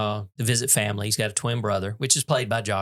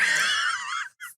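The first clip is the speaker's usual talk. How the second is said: high squealing laugh; shrieking laugh. Real, not acted. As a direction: burst into a shrill laugh. Laughing at the joke.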